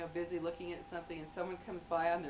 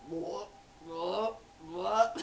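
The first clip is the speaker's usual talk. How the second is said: mock pet heaving noises; imitated dog retching; 4 dB louder, much slower than usual. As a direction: heave it up like a sick dog. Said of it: "your dog is puking".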